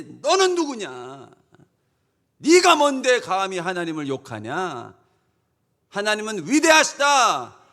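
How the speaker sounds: loud, high battle challenge; David defying Goliath. Acted. David before Goliath proclaiming God, defiant shout. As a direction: hurl it like a warrior's defiant cry. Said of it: "다윗처럼 다 골리앗 앞에서".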